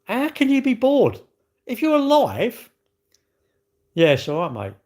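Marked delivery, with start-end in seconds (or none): 0.0-2.7 incredulous. Real, not acted. Ranting at bored people.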